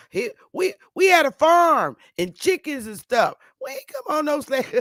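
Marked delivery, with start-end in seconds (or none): 3.6-4.7 high, lightly voiced old southerner; a hint of a proud old relative; elder's denial. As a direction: mimic the elder insisting.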